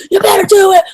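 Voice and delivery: mock yell. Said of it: "they just yell at him".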